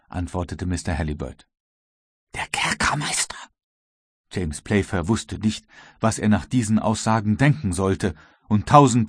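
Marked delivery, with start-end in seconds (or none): 2.3-3.4 breath-only, high astonished echo; stunned query. A narrator's reading. Captain learns who led him here, stunned whisper. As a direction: whisper it back in disbelief.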